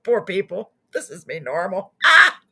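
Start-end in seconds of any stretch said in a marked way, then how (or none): 2.0-2.4 sudden loud, high yelp; mock scream. Host startles viewers after "this is me normal", mock scream. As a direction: burst out with a short playful scream. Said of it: "I don't know why I do that".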